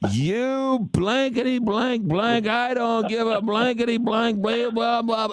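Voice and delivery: high, drawn-out imitated rant; mock dressing-down. Umpire tearing into a heckling fan, mock angry rant. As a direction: imitate an umpire chewing someone out. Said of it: "he dresses me down like no one's business".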